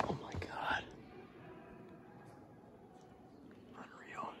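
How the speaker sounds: hushed awed cave whisper. Real, not acted. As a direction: whisper softly, full of wonder.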